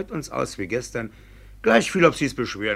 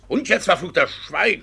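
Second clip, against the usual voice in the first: loud, high furious command; bellowing judge losing his temper; angry shout; 7 semitones higher, 6 dB louder than usual. Acted. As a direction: shout him down to silence.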